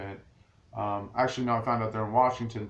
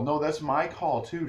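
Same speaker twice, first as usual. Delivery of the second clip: retold loud protest; 5 semitones higher, 3 dB louder than usual. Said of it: "I'm saying this very loud in the mall".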